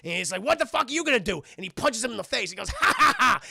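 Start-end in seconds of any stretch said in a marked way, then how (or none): 0.4-1.4 loud, high, acted challenge; retold angry outburst; confrontational. Acted quarrel before a punch. 2.7-3.4 loud, high mock cackle; acted gloating "ha ha ha"; hammed-up victory laugh; triumphant laugh. Character laughs after punching.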